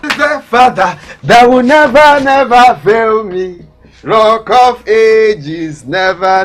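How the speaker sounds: loud, drawn-out shouts of triumph; exultant, high and booming testimony; jubilant praise. Acted. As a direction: proclaim the breakthrough with big held words. Rejoicing that God came through.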